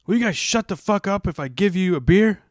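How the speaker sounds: loud high shout out the window; yelling out window. Retold or imitated. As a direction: bellow down at the kids.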